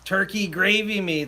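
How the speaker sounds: ordinary delivery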